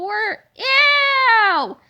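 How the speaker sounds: high, loud grossed-out ew; disgusted ew. A narrator's reading. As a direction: squeal with disgust. George disgusted again.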